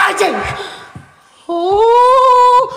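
sudden high yelp of fright; startled cry. Real, not acted. Startled by something in the dark. (teriakan kaget melengking)

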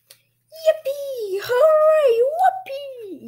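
very high, loud cheering; jubilant yell. A narrator's reading. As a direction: whoop with glee at the top of your voice. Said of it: "yelled Clay".

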